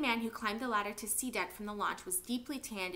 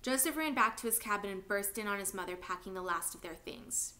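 usual speaking voice

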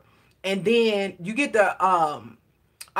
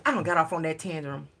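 usual speaking voice

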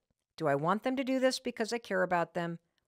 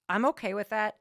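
usual speaking voice

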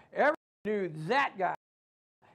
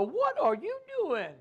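high, drawn-out incredulous question; the body balking in disbelief; mock startled voice of the body; 10 semitones higher, slower than usual. Body's voice, startled.

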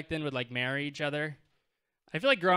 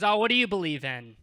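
mock angry challenge; 4 semitones higher, 6 dB louder than usual. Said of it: "she got mad".

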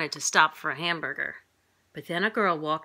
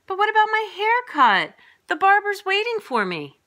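put-on high, loud child's protest; pleading child; 7 dB louder than usual. A narrator's reading. Boy to mom wanting his haircut, pleading.

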